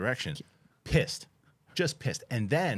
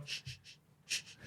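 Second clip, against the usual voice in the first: hushing; 15 dB quieter than usual. Retold or imitated. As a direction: re-enact hushing an angry driver. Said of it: "so I'm going".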